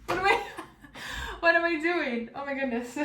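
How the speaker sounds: high, loud laugh through the words; retold fluster, high-pitched and laughing. Shopper mocking herself for forgetting her card, flustered laughing. Acts out flustered moment at checkout.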